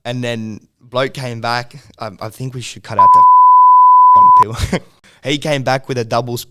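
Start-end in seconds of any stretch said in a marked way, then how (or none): 3.3-4.4 a sudden high, loud cackle of laughter; loud burst of laughter. Real, not acted. Telling the story, he cracks up with a loud laugh. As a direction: break into a loud laugh mid-sentence. Laughing mid-sentence on podcast.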